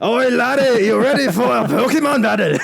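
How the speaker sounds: high, loud hammed-up Scottish voice; Scottish accent. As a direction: shout it in a cartoon Scottish accent. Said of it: "He's, like, Scottish or something".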